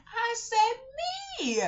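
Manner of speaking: put-on high loud little-girl answer; high child voice saying me. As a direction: chirp it like a child.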